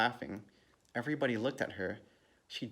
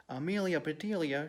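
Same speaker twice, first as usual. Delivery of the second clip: higher, mildly exclaimed name; dismayed cry; 7 semitones higher than usual. A narrator's reading. Missus Rogers finds the cut flowers, dismayed cry. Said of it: "she cried".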